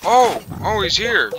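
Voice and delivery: high, loud startled cry; startled shout. Real, not acted. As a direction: shout as the enemy appears. Enemy appears.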